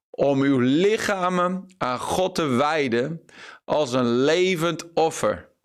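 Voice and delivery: slow, weighty rereading of the phrase; slow emphatic reread. Shown as a demonstration. Preacher rereading the key verse to his viewers, slow and weighty. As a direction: lay each word down slowly. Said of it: "dat middelste stukje nog een keer lezen".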